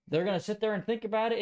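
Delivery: usual speaking voice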